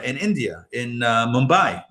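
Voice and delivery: ordinary delivery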